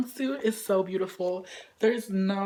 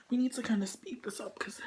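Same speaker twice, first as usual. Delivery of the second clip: fast whisper; 8 dB quieter, faster than usual. Real, not acted. Whispered aside.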